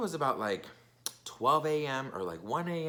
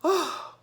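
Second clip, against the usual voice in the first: heavy sigh; 5 dB louder than usual. Real, not acted. Sigh before starting the story.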